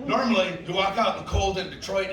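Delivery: normal talking voice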